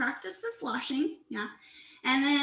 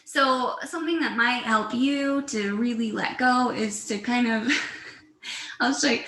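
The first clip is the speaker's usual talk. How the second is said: usual speaking voice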